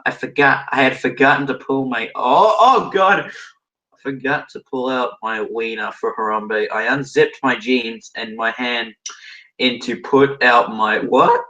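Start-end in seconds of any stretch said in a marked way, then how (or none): 2.1-3.3 high, loud, drawn-out dismay; real appalled outcry. Real, not acted. Reader hitting a crude line, appalled outcry. 11.0-11.4 very high squeak of disbelief; incredulous. Real, not acted. Reader stopping at a strange phrase, incredulous.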